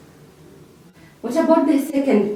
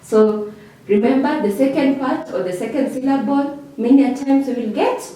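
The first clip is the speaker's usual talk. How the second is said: normal talking voice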